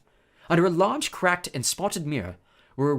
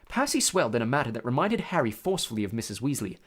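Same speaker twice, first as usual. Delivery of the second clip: usual speaking voice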